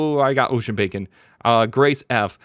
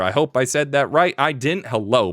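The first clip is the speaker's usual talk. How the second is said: ordinary talk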